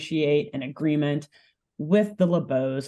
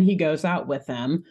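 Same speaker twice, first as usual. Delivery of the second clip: ordinary delivery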